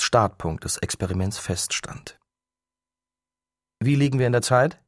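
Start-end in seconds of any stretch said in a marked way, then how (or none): 3.8-4.8 calling out. A narrator's reading. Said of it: "rief Hiroshi".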